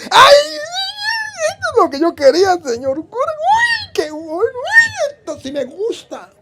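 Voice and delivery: put-on a child thrilled like it's candy; overjoyed little boy; delighted child. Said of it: "como si estuviera comiéndose un caramelo".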